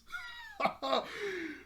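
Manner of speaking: very high real laugh; high burst of laughter. Real, not acted. Host cracking up at the wolves, high laugh.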